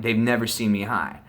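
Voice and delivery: plain reading voice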